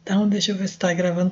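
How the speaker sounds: ordinary talk, nothing marked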